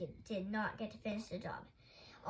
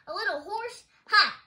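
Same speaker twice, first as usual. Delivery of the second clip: high, loud echo, then a scoff; incredulous and mocking; scoffing disbelief; 10 semitones higher, 14 dB louder than usual. A narrator's reading. Scoffing at the caller's claim.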